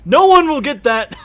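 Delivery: high, loud, breaking into laughter; amused outburst. Real, not acted. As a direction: exclaim loudly as laughter takes over. Game master cracks up at joke.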